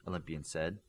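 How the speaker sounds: usual speaking voice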